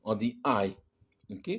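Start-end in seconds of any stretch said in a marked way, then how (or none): 0.4-0.8 I said as English "eye"; I as the joined sound "ai"; diphthong. Shown as a demonstration. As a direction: glide from A to I in one syllable, "aï". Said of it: "on appelle ça une diphtongue".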